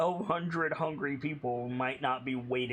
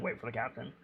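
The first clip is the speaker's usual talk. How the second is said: quiet gruff quote; 3 semitones lower, 6 dB quieter, faster than usual. Retold or imitated. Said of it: "the Rev also said".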